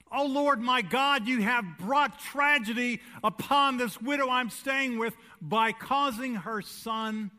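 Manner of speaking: loud, pleading cry to God; Elijah crying out. Acted. Preacher as Elijah crying out, loud and pleading. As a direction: cry out to the Lord with force.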